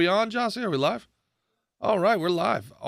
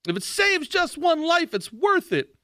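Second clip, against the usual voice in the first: mock scold; 12 semitones higher than usual. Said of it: "they were yelling at us".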